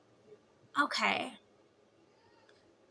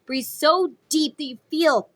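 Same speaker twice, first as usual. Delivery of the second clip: normal talking voice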